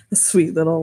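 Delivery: normal talking voice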